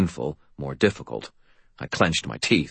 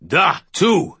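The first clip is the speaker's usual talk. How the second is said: booming Russian; 12 semitones higher, 5 dB louder than usual. Acted. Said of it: "his deep voice booming".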